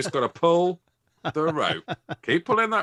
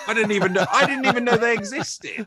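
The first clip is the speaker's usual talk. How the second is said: loud, high comic outburst; squealed incredulous exclamation; hammed-up disbelief; 12 semitones higher, 7 dB louder than usual. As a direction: shout it in mock amazement, near laughter. Laughing amazement at crop dusting helicopter.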